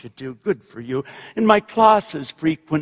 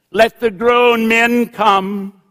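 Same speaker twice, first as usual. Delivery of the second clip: slow, moderately raised call; mild preacher's shout; 6 semitones higher, 5 dB louder, slower than usual. A narrator's reading. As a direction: summon them slowly, projecting. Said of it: "she shouted".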